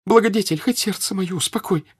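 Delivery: high, imploring wail; pleading cry. A narrator's reading. Woman begs Pierre to help, crying out. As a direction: beseech him in a high cry. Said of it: "закричала она".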